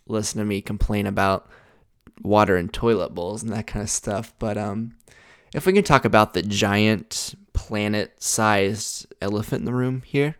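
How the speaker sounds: ordinary talk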